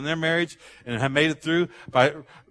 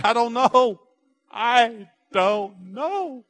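higher-pitched, drawn-out admission of defeat; exasperated parent; 7 semitones higher, 3 dB louder, much slower than usual. Acted. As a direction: give up and stretch it out slowly the second time. Worn out by child's endless questions.

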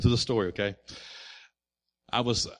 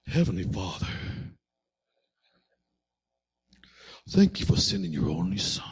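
a lightly voiced gentle preacher's prayer, much lower and softer; Max Lucado prayer; 8 semitones lower, 5 dB quieter than usual. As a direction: pray it smooth and careful like a famous author-pastor. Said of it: "the best Max Lucado prayer".

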